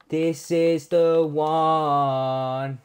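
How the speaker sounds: very slow, stretched hopeful words; drawn-out anticipation. Acted. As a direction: drag it out in suspense. Anticipating Tavernier pack.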